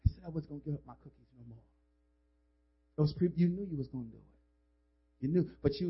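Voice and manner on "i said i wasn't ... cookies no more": much softer, fast self-reproach; regretful mutter. Retold or imitated. Young woman leaving at dawn, quiet regret. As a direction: mumble the regret. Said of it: "and say".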